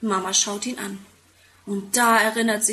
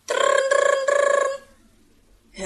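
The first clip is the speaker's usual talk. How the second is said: very high rolled-r doorbell ring; doorbell imitation; 4 dB louder, much slower than usual. A narrator's reading. Narrator mimics the doorbell Tim presses, very high rolled ring. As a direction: buzz it out like a doorbell. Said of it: "Hört er es im Haus läuten".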